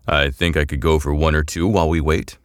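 a relaxed big-bodied crewman; hulking crewman accepting a beer; big man's voice. A narrator's reading.

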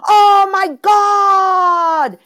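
high, loud, drawn-out squeal of delight; excited exclamation. Retold or imitated. Overjoyed stranger discovering a shared hometown, squealing. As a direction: stretch it out like meeting long-lost family.

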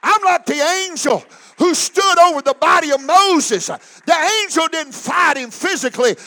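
loud, ringing preacher's shout; full-force preaching. Acted. Preacher rouses the Holy Ghost filled saints, shouting. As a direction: whip the saints up to their feet.

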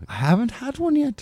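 high Irish lilt; put-on Irish accent. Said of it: "It's the Irishness".

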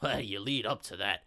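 a low, menacing gangster voice; lightly voiced menacing gangster; menacing villain. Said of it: "you hear him go".

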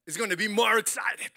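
loud, eager reply; shouted cheerful agreement; eager yes. Shown as a demonstration. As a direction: show them the answer with joy. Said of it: "you have to say".

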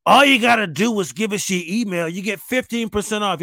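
guilt-trip like a snooty ad; pop-up icon shaming the shopper, mock snooty; snotty pop-up. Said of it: "icon will pop up like".

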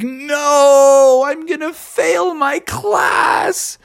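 high, slow, hammed-up crying shout; mock sob, very high and drawn out; wailing Bubby. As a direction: wail it out like a tantrum. Bubby wails about failing.

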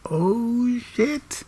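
startled at the party as the actress walks in, high gasped shock; shocked oh shit. Retold or imitated. High breathy shock. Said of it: "And I was like".

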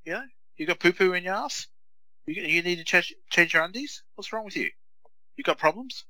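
high, mocking baby-talk taunt. Mocking the rude kid who kicked him from the car, high taunting voice. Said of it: "yeah, I said".

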